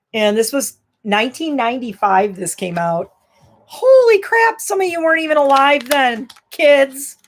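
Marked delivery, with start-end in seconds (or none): 3.7-7.2 high, loud holy crap; shocked at how long ago it was. Real, not acted. Showing old CD to viewers, mock shocked outburst.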